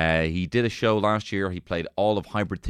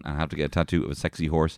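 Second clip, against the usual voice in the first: ordinary delivery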